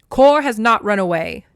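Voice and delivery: loud, sharp scolding mother; mom voice. Acted. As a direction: snap it like a strict mom. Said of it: "I'm having to get into my mom voice there for Demeter".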